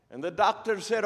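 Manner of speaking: excited Scottish storyteller; Scottish brogue. Acted. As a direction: build the drama in a put-on Scottish brogue. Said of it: "best Scottish brogue I can come up with".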